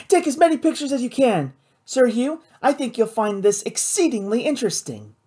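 high, eager voice settling into pride; eager young Kate, excited. A narrator's reading. Kate directs photographer and Sir Hugh, high and eager. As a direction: urge them on in a high, excited voice.

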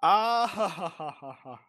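high laugh, almost crying; laugh-cry in disbelief; nervous laugh. Real, not acted.

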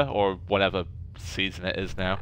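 ordinary delivery

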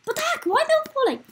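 high, loud disbelief; squealed surprise; astonished. Real, not acted. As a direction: exclaim in amazed amusement, high and loud. Amused as other players all fall.

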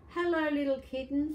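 put-on friendly boy greeting a kitten. Grandma reading, boy greets kitten, lightly voiced boy. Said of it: "said a boy".

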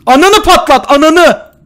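loud, high furious shout. Real, not acted. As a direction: explode at the game in rage. Balon patlayınca küfür. (öfkeyle haykırarak)

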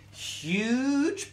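"huge" stretched long, sweeping up in pitch; emphatic drawn-out word. A narrator's reading. Storyteller impressing children with the paws, "huge" stretched long. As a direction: draw out "huge" to show the size.

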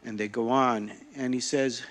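normal talking voice

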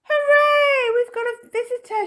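put-on very high, loud child's cheer; excited shout. A narrator's reading. Minx greeting a visitor, shouted high and excited. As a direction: shout it in gleeful welcome, high-pitched. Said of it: "shouted Minx".

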